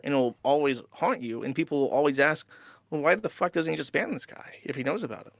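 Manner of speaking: ordinary delivery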